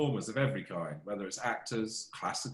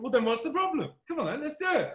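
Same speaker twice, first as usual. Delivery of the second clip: egging on; 12 semitones higher than usual. Retold or imitated. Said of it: "It's like".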